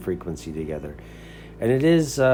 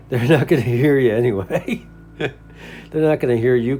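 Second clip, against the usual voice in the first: chuckling through it, a bit louder; laughing; 4 semitones higher, 5 dB louder than usual. Real, not acted. To fellow radio operators about loud stations, laughing.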